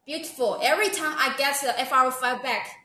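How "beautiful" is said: normal talking voice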